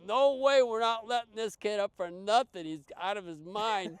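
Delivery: high, loud alarmed protest; staff refusing. Retold or imitated. Staff refuse to release kid.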